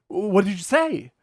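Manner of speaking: high, breathless, hooked on the gossip; the agent, thrilled by the proposal story; enraptured. Retold or imitated. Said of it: "he goes".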